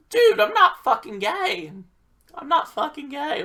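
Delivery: high-pitched, indignant retold outburst; exasperated protest. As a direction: protest it loudly, voice jumping up.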